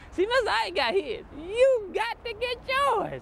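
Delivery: hammed-up imitation of Mister Ike; Mister Ike impression. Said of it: "that Mister Ike is an absolute comedian".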